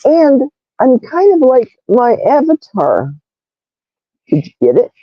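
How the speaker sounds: ordinary talk, nothing marked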